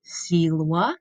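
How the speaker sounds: silwa with W sound for V. Shown as a demonstration. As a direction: say silwa as sil-wa, V as W. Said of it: "You can hear I'm pronouncing that V like a W".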